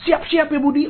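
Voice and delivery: put-on excited boy Tono. Tono boasts to Budi before his big kick, high and excited. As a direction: call across the field, bragging and eager. Tono voiced calling teammate.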